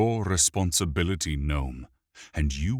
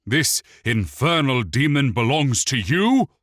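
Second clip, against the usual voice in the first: higher, louder furious demand; raging; 8 semitones higher, 8 dB louder than usual. A narrator's reading. The elf prince rages at Wick about the demon, furious. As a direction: rage at the owner of the demon. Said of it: "Mirthandir raged".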